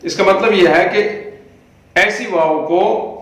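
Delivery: ordinary talk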